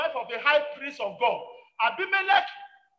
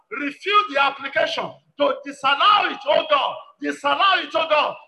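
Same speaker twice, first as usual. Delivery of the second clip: loud, emphatic preaching prayer in short bursts; forceful prayer leading; 6 dB louder than usual. Real, not acted. Preacher pleading with God to reject Satan's plans, forceful prayer. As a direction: cry out each petition with insistence.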